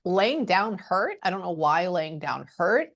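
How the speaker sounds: ordinary talk, nothing marked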